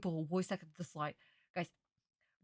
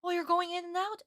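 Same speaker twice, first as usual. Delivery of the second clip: normal talking voice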